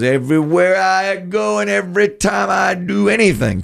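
very high, sustained insistent voice; emphatic quote. Retold or imitated. Imitating the screenwriter fed up with questions, high and emphatic. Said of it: "He says emphatically".

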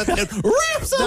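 high-pitched mimicry of a cartoon mouse; Mickey Mouse. Host echoes the guest's enthusiastic ending, high mock mouse voice. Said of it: "That's how he talks".

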